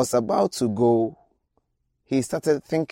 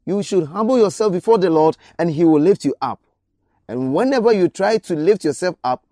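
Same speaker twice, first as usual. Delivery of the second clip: raised, urgent altar call; fervent preaching; 5 semitones higher, 4 dB louder than usual. Acted. Preacher urges the congregation loudly, fervent preaching. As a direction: press the hearers to stop rushing.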